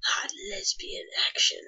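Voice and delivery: hushed stage whisper; soft, voiceless joke seduction; mock-sultry whisper. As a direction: whisper it like a naughty tease. Mock fan service, announced in a whisper.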